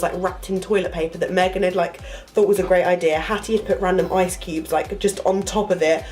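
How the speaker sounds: ordinary delivery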